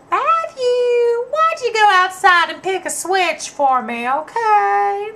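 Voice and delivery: sugary high Alabama grandma sending him for a switch; cute Southern grandma. Retold or imitated. Grandmother sweetly telling the boy to fetch a switch, high and sweet. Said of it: "real cute Southern lady from up Alabama".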